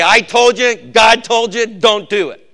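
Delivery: high, loud scolding; prophet parent scolding. Retold or imitated. Parent scolds child, loud high prophetic command. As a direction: scold your kid like a prophet. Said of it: "You're a prophet".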